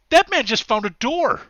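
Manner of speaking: high, loud cry of alarm; startled tourist shout. Acted. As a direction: shout it out as a shocked bystander. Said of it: "somebody says".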